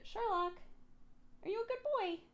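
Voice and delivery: high cooing pet voice. Real, not acted. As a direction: coo sweetly at the cat. Talking sweetly to the cat.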